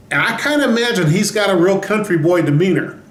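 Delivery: ordinary talk, nothing marked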